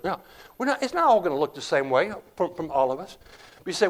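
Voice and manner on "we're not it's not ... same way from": normal talking voice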